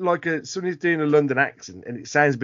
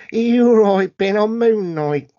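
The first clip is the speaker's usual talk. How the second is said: bad Cockney accent; 2 semitones higher, 5 dB louder than usual. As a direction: call out in terrible mock Cockney. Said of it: "real bad Cockney accent".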